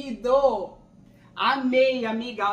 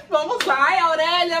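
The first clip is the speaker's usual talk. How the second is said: nervous excitement; 4 semitones higher, 8 dB louder than usual. Real, not acted. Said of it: "que ansiedade".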